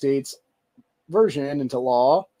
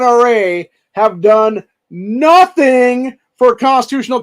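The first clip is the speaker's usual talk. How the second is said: loud, higher, slow furious tirade at the NRA; real outrage, raised voice; angry rant; 7 semitones higher, 8 dB louder than usual. Real, not acted. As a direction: hammer each insult home. Ranting directly at the NRA.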